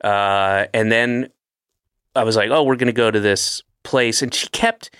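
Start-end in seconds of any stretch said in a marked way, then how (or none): none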